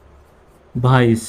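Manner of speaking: Advice stretched out very slowly, syllable by syllable; drawn-out teacher demo of advice; slow model word. Shown as a demonstration. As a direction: say advice slowly for the class to copy. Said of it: "teacher models the word slowly".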